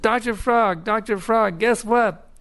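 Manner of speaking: put-on high, eager calling of the old frog's name; little frog bursting with news, pitched high; excited little frog. Little frog calls excitedly to his teacher. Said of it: "in great excitement".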